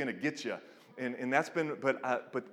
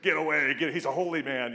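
crusty disciples; 4 dB louder, faster than usual. Retold or imitated. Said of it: "crusty old disciples like".

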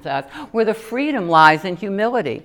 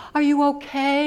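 higher, gentle concerned asking; sweet woman; 8 semitones higher than usual. Retold or imitated. Kind woman at the altar asks, lightly voiced. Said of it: "this sweet woman says".

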